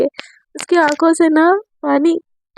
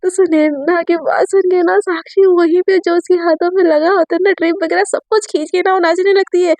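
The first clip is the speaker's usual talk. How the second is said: usual speaking voice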